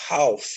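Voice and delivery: "half" with an "ow" vowel; wrong "half". Shown as a demonstration. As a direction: mispronounce "half" as "hauf". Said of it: "Não se pronuncia essa frase".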